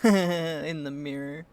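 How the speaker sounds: slow words through a real laugh. Real, not acted. Laughing as the ghost shows up in the mirror. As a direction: laugh it out, shaken.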